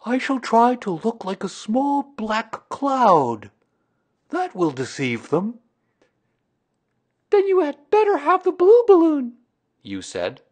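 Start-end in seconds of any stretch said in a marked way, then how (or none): 0.1-5.6 high, loud bear voice with drawn-out words; Pooh, deliberate announcing. A narrator's reading. Pooh announcing his cloud disguise, deliberate and pleased. 7.3-9.4 put-on very high, loud little-boy voice; child's voice. A narrator's reading. Boy advising Pooh on balloons, high child's voice.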